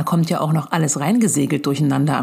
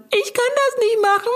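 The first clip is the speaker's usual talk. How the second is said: put-on much higher, whining like a stubborn little kid; the brain throws a childish tantrum, pitched way up; whiny brain voice. The brain refuses the word problem, in a high whiny kid voice. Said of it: "Und dann sagt mein Gehirn".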